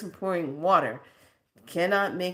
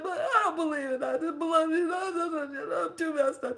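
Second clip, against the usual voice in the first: high wail with laughing sobs; crying mess; 12 semitones higher than usual. Retold or imitated. To the elder, crying she can't take it. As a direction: cry and laugh out the despair. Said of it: "I was just a crying mess".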